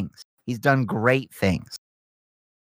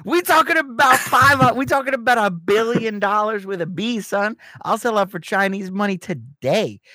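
excited; 8 semitones higher than usual. Pretend. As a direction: crow about the money. Gleeful sellout rant.

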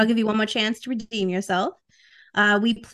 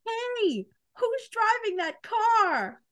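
higher, slower, breathier movie voice; bigger character voice; 10 semitones higher, 5 dB quieter, much slower than usual. Shown as a demonstration. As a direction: push the character further. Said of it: "do the voice a little, a little more".

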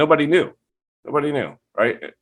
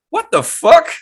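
much higher, squeaked disbelief; incredulous; 6 dB louder than usual. Retold or imitated. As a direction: shriek it in utter disbelief. Roommate wins Cannabis Cup.

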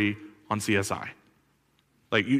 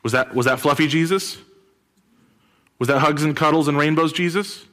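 louder mocking rhetorical question; sarcastic question; 5 semitones higher, 5 dB louder than usual. Real, not acted. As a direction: scoff at fluffy Jesus. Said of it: "mocking soft Jesus".

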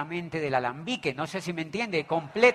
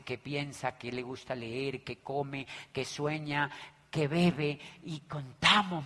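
ordinary delivery

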